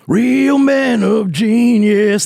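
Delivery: high, slow sung-out announcer; commercial jingle voice. Retold or imitated. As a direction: belt it like a beer ad singer. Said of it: "that's a commercial".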